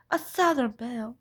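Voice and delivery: ordinary talk, nothing marked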